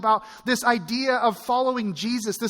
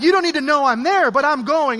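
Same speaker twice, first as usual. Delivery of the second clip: louder, higher insistent retort; defiant dad; 5 semitones higher, 7 dB louder than usual. Retold or imitated. Dad answering his girls' text, defiant and loud. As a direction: dig in, loud and stubborn.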